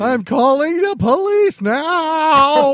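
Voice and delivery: loud, slow, high panicked yell; panicked shout. Pretend. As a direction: shout it like a terrified bystander. Mock panicked call to police.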